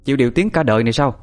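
annoyed rebuke. A narrator's reading. Grandmother confronting the widow over slandering her father, indignant rebuke. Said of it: "Bà tôi tức giận khó chịu".

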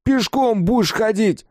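high, loud angry yell; shouting. A narrator's reading. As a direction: bellow it furiously. Kolyan yells at shooter.

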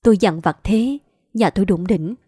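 plain reading voice

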